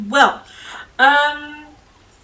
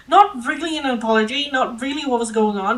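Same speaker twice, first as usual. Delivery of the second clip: usual speaking voice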